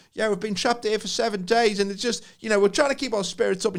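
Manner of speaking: put-on high-pitched caller; trapped pub caller. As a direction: imitate a stranded local on the phone to the news. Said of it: "someone's on the pub phone".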